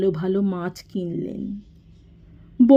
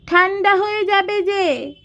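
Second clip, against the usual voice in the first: much higher, put-on voice calling after him; household member calling out; 11 semitones higher than usual. A narrator's reading. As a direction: call out high to someone leaving. Same high voice protesting. (উঁচু গলায় পিছু ডাকা)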